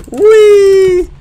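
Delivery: very high, loud whee; excited squeal. Real, not acted. Gamer having fun with the toy, squealing. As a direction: let out a long playful squeal.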